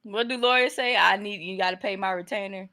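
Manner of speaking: ordinary talk, nothing marked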